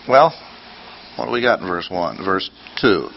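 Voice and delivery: usual speaking voice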